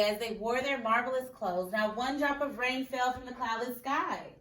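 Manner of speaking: usual speaking voice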